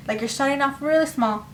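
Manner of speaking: ordinary talk, nothing marked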